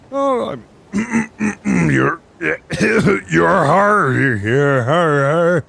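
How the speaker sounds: high strained put-on voice, coughing; character voice warm-up. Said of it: "just give me a little bit".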